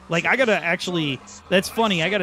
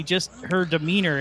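ordinary delivery

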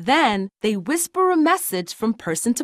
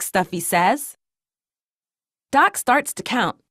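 ordinary delivery

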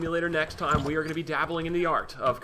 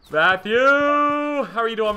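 loud greeting; 8 semitones higher, 11 dB louder, much slower than usual. Acted. Greeting buyer loudly in game.

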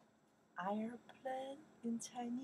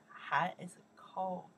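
ordinary talk

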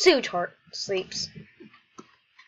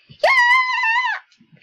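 very high, drawn-out yell; hammed-up squeal of joy; shouted cheer; 10 dB louder than usual. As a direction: let out a long shrieking cheer. Acted cheer in read dialogue.

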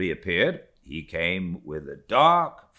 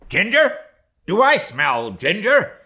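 high, loud sniffing query on ginger rising; mate suspiciously questioning the steward, high and loud; 10 dB louder than usual. Acted. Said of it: "suspiciously asked Stubb".

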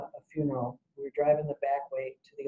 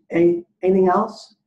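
loud, higher, questioning order taker; drive-through order taker; 4 semitones higher, 9 dB louder than usual. Retold or imitated. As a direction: call out the question at the order window. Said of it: "it goes something like this".

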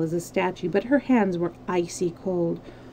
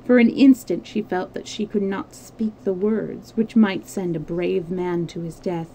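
usual speaking voice

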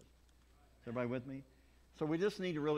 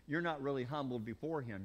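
usual speaking voice

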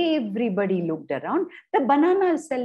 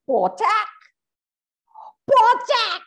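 louder and higher potak thuds; thud sounds; 11 semitones higher, 4 dB louder, slower than usual. Acted. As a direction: bang out the falling bananas.

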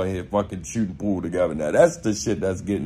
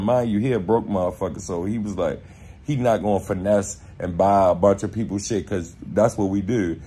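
normal talking voice

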